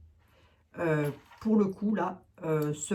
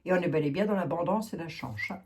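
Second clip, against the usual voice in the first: ordinary delivery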